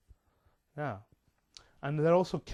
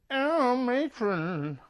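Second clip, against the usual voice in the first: comic matron voice; 9 semitones higher, 4 dB louder, much slower than usual. Acted. Comic put-on voice after voices.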